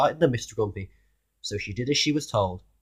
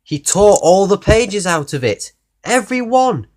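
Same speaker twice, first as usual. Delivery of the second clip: shocked reveal; 7 semitones higher, 7 dB louder than usual. A narrator's reading. Shocked reveal to child listener.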